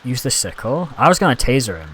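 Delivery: normal talking voice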